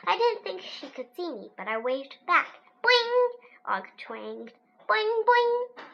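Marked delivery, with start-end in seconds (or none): 2.8-3.3 high springy boing; frog twang. A narrator's reading. 4.9-5.8 high springy boing; frog twang. A narrator's reading.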